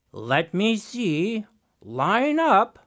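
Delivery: slow, drawn-out sly command; deliberate scheming Raven; inspecting Raven. Acted. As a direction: trickster feigning scrutiny.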